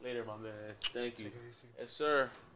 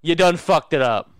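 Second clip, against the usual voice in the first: Angry Joe impression; 3 semitones higher, 6 dB louder than usual. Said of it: "In the words of ... Angry Joe Show".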